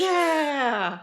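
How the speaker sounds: big high laugh, out loud; loud laughter. Real, not acted. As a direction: burst out laughing at the joke. Laughing at celebration sounds.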